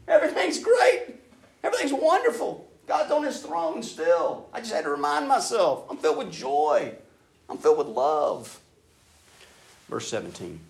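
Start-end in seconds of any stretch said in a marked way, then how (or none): 0.1-8.6 high, loud, bubbling with joy and laughter; the psalmist bursting with happiness; overjoyed. Retold or imitated. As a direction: throw a party with your voice. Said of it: "He's like".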